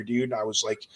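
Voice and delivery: normal talking voice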